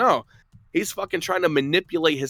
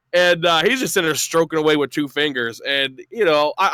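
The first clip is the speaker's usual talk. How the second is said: ordinary talk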